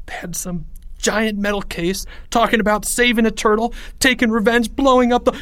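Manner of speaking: high-pitched frightened rush of detail; panicked babble. A narrator's reading. Terrified Leo describing the men, panicked.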